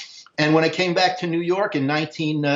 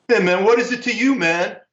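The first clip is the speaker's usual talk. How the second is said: high-pitched, defensive retort, acted out; wary challenge; 6 semitones higher than usual. Saxophonist to unrecognized old friend backstage, wary challenge. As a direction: push back at a nosy stranger.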